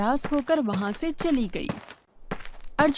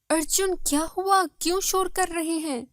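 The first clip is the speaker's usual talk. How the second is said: high-pitched, puzzled wife voice; startled wife; 5 semitones higher than usual. Acted. Wife woken by her husband's noise, startled. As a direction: ask in alarm what the fuss is.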